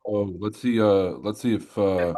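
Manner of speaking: ordinary talk, nothing marked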